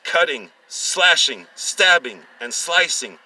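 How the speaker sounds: loud, slow, high gory relish; dramatic build. A narrator's reading. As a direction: linger on each brutal word. Gory climax narration.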